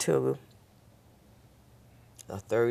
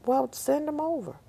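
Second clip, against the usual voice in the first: much higher breezy mock voice; agreeable doctor; 4 dB louder than usual. Neurologist on the phone agreeing, much higher voice. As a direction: toss off an easy yes.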